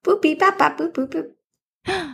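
high beeping robot reply. Pretend. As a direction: beep back like a robot. Answering in robot beep code.

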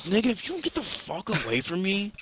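high-pitched mock exasperation; exasperated rebuff. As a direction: fend off a pest, pitch shooting up. Retold annoyed reply to friend.

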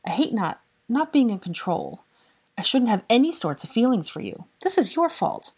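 high, frustrated complaint; upset blaming. A narrator's reading. Granger blames Draco for her unwanted feelings, upset. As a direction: complain it, climbing in pitch to an accusation.

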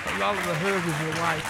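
usual speaking voice

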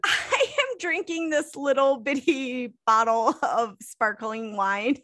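ordinary talk, nothing marked

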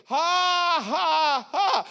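very high, stretched "ha"s; defiant forced laugh. Retold or imitated. Preacher as Hagin mocking the devil, high forced laugh. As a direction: imitate laughing in the devil's face.

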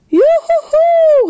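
long, very high loud whoop; calling out. A narrator's reading. Julebug charging to the children's rescue, whooping call. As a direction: whoop it far across the ice. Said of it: "rief Juleburg".

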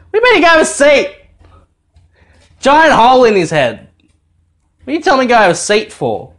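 high-pitched outraged disbelief; retold indignant protest. Dad acting out his reply to "go have a seat", indignant protest. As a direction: fire back in mock outrage. Acted protest at being seated.

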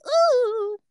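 high drawn-out "ooh"; high exclaimed ooh. Real, not acted. Recalling the rough first month, high exclaimed ooh.

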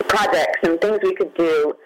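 ordinary talk

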